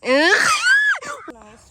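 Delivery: loud, high burst of delight; excited shout. Real, not acted. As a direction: belt it out, thrilled.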